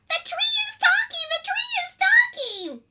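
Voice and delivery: very high, loud parrot screech; screaming parrot. Acted. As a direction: shriek it like an alarmed parrot. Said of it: "screamed the parrot".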